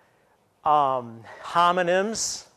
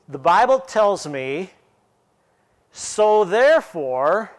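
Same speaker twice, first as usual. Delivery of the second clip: slow "The Bible tells me," a long pause, then a louder rising "so therefore,"; 4 dB louder, slower than usual. Shown as a demonstration. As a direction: hold the comma, then push "so therefore" forward. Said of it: "put the comma in there".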